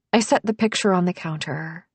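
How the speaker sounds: ordinary delivery, nothing marked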